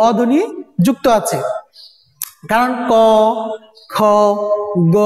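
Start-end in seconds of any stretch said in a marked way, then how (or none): 2.9-5.0 ক, খ, গ each said alone with an open "aw" vowel; ক, খ, গ with pauses between, open vowel held; inherent vowel demo. Shown as a demonstration. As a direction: sound out ক, খ, গ separately as kaw, khaw, gaw. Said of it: "দেখো অ আ উচ্চারিত হয়".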